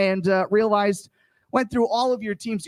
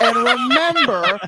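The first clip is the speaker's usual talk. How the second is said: hammy host; 5 dB louder than usual. Acted. Game host intro, laughing.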